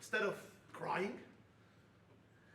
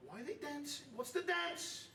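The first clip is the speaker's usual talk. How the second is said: higher-pitched questioning; puzzled demand; 9 semitones higher than usual. Retold or imitated. Prison warden asking about dancing prisoners, puzzled demand. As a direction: ask it as a bewildered demand.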